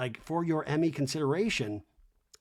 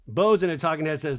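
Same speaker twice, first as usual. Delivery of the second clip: ordinary talk, nothing marked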